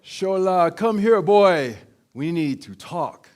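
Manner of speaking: put-on dad bellowing for his boy to come talk; booming dad voice. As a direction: call the son in like a commanding African father. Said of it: "That's what I hear in my head".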